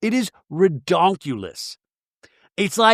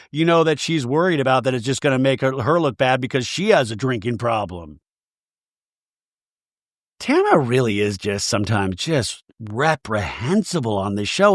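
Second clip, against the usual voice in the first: ordinary talk, nothing marked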